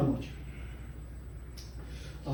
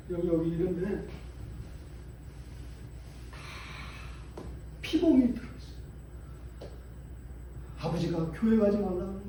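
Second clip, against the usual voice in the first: hushed sorrow; 4 semitones higher, 8 dB quieter than usual. Real, not acted. Moved retelling of abuse.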